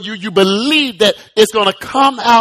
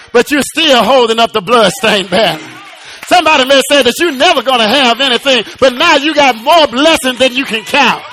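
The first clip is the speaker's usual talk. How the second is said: raised, quick sermon delivery building to a high peak; full-force preaching; 5 semitones higher, 3 dB louder than usual. Acted. Preacher promising the congregation God will turn it around, rousing preaching. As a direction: drive it upward like a rousing preacher.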